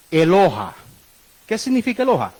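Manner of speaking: ordinary talk, nothing marked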